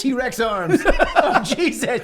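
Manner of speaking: laughing. Real, not acted. Player cracking up at the spider's tiny arms, laughing.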